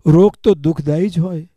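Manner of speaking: normal talking voice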